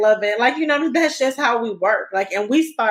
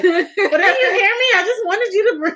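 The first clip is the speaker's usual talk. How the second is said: high, raised mock holler breaking into laughter; exasperated calling out; 11 semitones higher, 4 dB louder than usual. Wife retelling her call to husband, exasperated and laughing. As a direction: plead loudly through a laugh.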